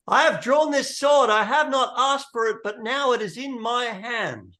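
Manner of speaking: high, loud kingly proclamation; inspired cry. Acted. Arthur, inspired, holding the drawn sword, loud impassioned cry. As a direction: ring it out to the whole crowd, easing slightly. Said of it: "gives this cry".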